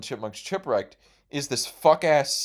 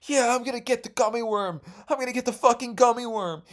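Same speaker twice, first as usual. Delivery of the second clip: put-on much higher, eager little kid voice; excited kid self; 11 semitones higher than usual. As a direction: gush like a kid about to win. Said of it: "I was like".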